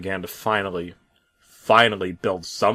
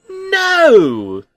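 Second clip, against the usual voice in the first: very high, loud delighted shout; gleeful yell; 11 dB louder than usual. Acted. Bob cutting off the truck, with a very high gleeful yell. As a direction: yell it grinning. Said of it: "Bob yelled".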